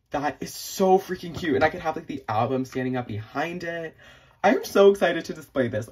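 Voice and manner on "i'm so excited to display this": higher and louder, beaming; bursting with glee; excited. Real, not acted. Telling viewers about her shelf, loudly excited. Gushing over the standee.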